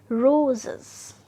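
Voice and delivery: Roses slowly, RO stretched and loud; slow stressed demo. Shown as a demonstration. Teacher repeating roses slowly, first syllable stressed. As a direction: draw out the RO of roses. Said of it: "first syllable पर हमने trace किया".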